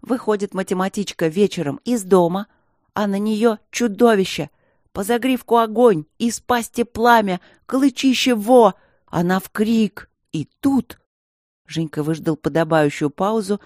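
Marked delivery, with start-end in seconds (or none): 0.0-11.0 rising louder scary tale; building dramatic storytelling. Acted.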